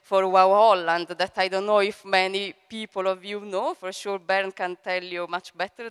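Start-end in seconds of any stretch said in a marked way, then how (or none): none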